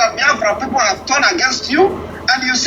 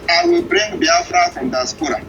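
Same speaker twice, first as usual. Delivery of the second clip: ordinary talk, nothing marked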